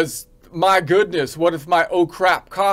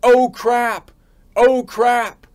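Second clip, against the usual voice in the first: two raised alarmed yells of oh crap; higher, louder mock alarm; mock yelling; 6 semitones higher, 4 dB louder, slower than usual. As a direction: yell it like people panicking. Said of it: "you've got people yelling all the time".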